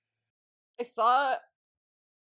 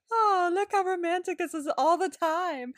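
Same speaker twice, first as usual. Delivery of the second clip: sing-song sarcastic gush, pitched higher; mock swooning; 7 semitones higher, 3 dB louder than usual. To her co-host about the couple, a mock swoon. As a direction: gush over the romance with a sneer.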